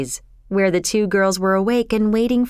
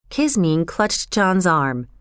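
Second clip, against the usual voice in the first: ordinary delivery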